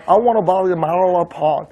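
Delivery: "마라로라" flapped inside a slow English sentence; American pronunciation demo. Shown as a demonstration. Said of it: "이렇게 얘기해요".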